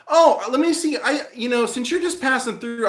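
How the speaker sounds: high-pitched imitation of an eager clerk; eager, impressed. Ticket clerk to visitor she thinks knows someone famous, mock-eager. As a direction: mimic someone suddenly keen to help, pitched high.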